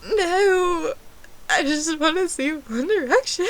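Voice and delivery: high, loud hammed-up whine; mock-distraught wail. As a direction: wail it like a heartbroken fan. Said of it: "So I got very sad".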